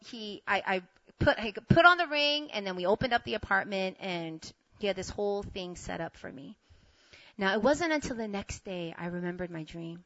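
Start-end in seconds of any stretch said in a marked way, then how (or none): none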